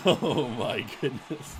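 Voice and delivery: slow laughing gasp of disbelief; disbelieving laugh. Real, not acted. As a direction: laugh in shock at the play.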